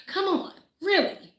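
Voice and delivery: high-pitched, fed-up incredulity; exasperated disbelief. Retold or imitated. Fed up with naysayers' talk, exasperated disbelief. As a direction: let the pitch leap in disbelief.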